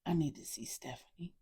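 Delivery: quiet, raspy voice of a woman still recovering; hushed, hoarse request from the sickbed. A narrator's reading. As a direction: rasp it out softly, worn from illness. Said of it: "she said in her still raspy voice".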